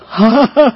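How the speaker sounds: short chuckle after the story; a brief, amused laugh. Real, not acted. 讲完和好故事后自己笑. (短促的笑声)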